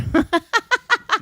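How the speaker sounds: high squealing laugh; high burst of laughter. Real, not acted. Laughing at the model joke, high squealing laugh. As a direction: let out a high delighted laugh.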